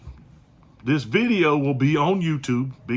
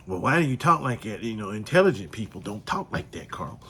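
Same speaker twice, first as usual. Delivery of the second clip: mock critic; 3 semitones lower than usual.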